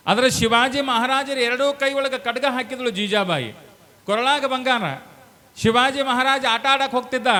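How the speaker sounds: loud, high storytelling declamation; full-force preaching. Real, not acted. Preacher tells mothers of young Shivaji, in full-force preaching. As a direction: ring it out high and loud to the crowd.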